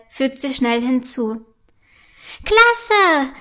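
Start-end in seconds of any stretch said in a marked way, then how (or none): 2.4-3.3 high, loud jubilant shout; loud cheer. A narrator's reading. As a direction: cheer it out with delight. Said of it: "jubelt Prinzessin Felicitas laut". (lauter Jubel)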